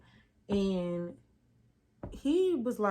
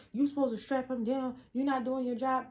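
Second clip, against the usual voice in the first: higher, accusing tone; blaming doctor; 5 semitones higher than usual. Retold or imitated. Doctor blaming his assistant, accusing and high. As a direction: point the finger hard.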